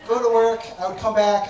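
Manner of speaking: usual speaking voice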